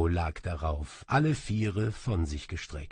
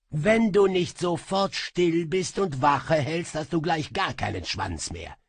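higher, louder snap of a threat; threatening; 8 semitones higher, 3 dB louder than usual. Acted. Sorcerer threatens his demon, sharply. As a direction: warn the imp sharply.

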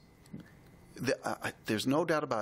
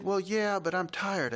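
put-on higher child's voice, tired; a tired little girl, pitched up; 7 semitones higher than usual. Quoting the girl Molly.

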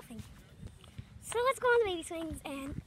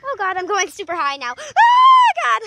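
loud scared shout, rising higher; panicked yell; 4 semitones higher, 15 dB louder than usual. Real, not acted. Kid swinging too high yells in panic. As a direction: cry out in alarm.

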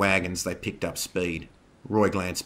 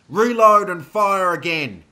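loud, slow, high battle order; drawn-out shouted firing order; booming command; 12 semitones higher, 10 dB louder, slower than usual. A narrator's reading. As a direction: bellow it to the troops. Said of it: "Edmund boomed".

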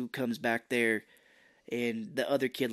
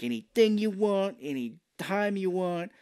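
Sam Rockwell; 7 semitones higher than usual. Retold or imitated. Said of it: "Sam Rockwell is".

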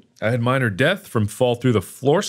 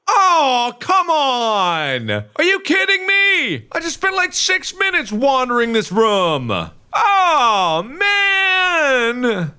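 frustrated yelling; 12 semitones higher, slower than usual. Real, not acted. Player who lost six minutes of wandering, frustrated yell. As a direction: howl in protest, stretching the big words. Lost progress after dying in game.

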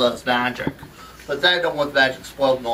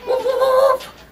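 high-pitched dog woofs; dog barking; 4 dB louder, much slower than usual. Acted. Hypnotized assistant obeying the wizard, high barking. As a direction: bark like an excited little dog. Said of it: "you're a dog".

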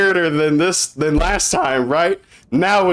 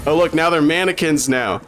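usual speaking voice

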